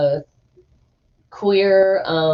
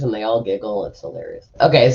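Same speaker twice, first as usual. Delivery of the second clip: normal talking voice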